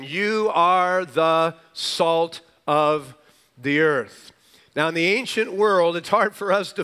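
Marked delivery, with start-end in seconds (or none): 0.0-4.3 very slow, every word of "You are the salt" weighted; word by word, YOU held long; affirmation. Shown as a demonstration. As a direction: proclaim it deliberately, pausing before "the earth". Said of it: "the affirmation".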